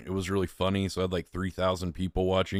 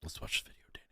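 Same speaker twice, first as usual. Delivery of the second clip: whisper; 14 dB quieter, much faster than usual. Real, not acted. Hushed aside before playing clip.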